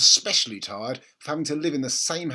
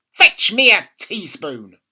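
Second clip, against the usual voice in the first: put-on slow, higher, louder old woman's command; bossy grandma; 5 semitones higher, 13 dB louder, slower than usual. A narrator's reading. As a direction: lay down the rule slowly. Grandma voiced in read story.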